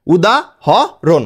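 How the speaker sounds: উদাহরণ said slowly in separate pieces; broken into syllables. Shown as a demonstration. Teacher showing students how to break up the word, উদাহরণ slowly syllable by syllable. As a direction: split উদাহরণ as উদা, হ, রণ with gaps. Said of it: "ভেঙে ভেঙে উচ্চারণ করবা".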